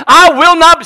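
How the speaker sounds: high, loud pulpit declaration; rallying shout. Real, not acted. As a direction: launch a chant for the crowd. Leads congregation in rallying chant.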